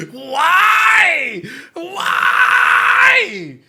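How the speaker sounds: real anguished cry; crying shout. Real, not acted. Streamer crying at the anime, wailing shout.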